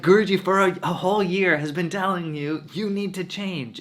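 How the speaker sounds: high, loud insistence; husband telling wife the guru meant her, high-pitched and exasperated; exasperated pleading. Retold or imitated. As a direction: press the point, fed up.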